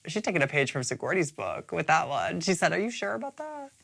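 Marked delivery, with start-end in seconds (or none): 2.7-3.7 soft, high mock challenge; imitating Abora. Host quoting Abora's comeback to an attack, soft high mock challenge. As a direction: quote the rival in a small, raised voice. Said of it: "then Abora's like".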